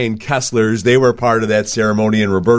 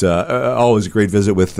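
normal talking voice

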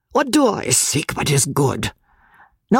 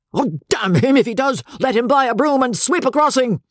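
high, rising anger building to a rant; father raging at his son's choice, high and building; gathering wrath; 6 semitones higher than usual. Acted. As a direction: build from irritation to fury. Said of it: "with gathering wrath".

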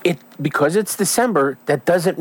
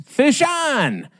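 high, drawn-out call with a falling end; fisherman's yell; 13 semitones higher than usual. Acted. Fisherman hooking a salmon on the charter, yelling. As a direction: holler it high like an angler with a bite. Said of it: "every fisherman loves to yell is".

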